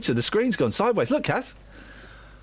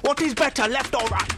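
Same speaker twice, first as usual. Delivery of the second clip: raised-pitch daft voice; mock lunatic; 5 semitones higher than usual. Imagining a nut job's questions, mock lunatic voice. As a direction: play the puzzled crank, hammed up. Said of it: "someone who types like that".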